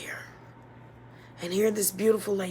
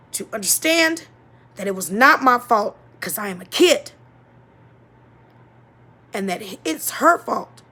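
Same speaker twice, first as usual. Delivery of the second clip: louder, rising emphasis on each reason; emphatic; 6 dB louder than usual. Real, not acted. Speaker insisting the blame was her mother's, louder and emphatic. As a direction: hammer home every point. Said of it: "insisting it was mother's fault".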